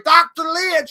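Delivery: high, loud cry for the vet; frantic mom shouting. Retold or imitated. Mom holding the dog, frantic shout. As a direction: imitate a panicked mother calling out. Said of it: "And she's like".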